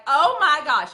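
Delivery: amazed exclaim. Retold or imitated. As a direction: shout it like the client can't believe it. Customer's mind blown.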